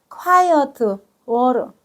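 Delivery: "quiet" said slowly with falling tune, then "water"; "quiet water" pronounced carefully. Shown as a demonstration. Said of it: "beside는 가에". ("quiet water"를 또박또박 천천히 발음)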